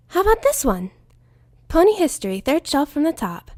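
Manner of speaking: high, bubbly pony voice; lightly high cheerful Pinkie; Pinkie Pie. Acted. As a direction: chirp it like an eager pony.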